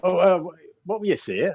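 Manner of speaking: high-pitched puzzled character; acted bewilderment; quizzical. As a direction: ask it high and hesitant, as if puzzled. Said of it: "he looks at you quizzically".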